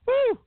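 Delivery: loud high whoop; excited whoop. Real, not acted. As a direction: let out a big radio woo. Morning radio hype.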